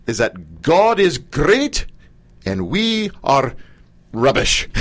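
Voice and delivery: slow, drawn-out Scottish brogue. Retold or imitated. Host imitates the Scottish comedian's hymn bit in a slow Scottish brogue. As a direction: roll it out slowly in a Scottish brogue. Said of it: "I can't actually say it the way he says it".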